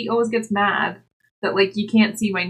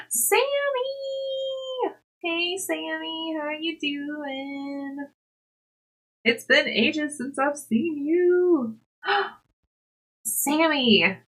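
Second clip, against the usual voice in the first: excited greeting; 7 semitones higher, much slower than usual. Real, not acted. Greeting a viewer.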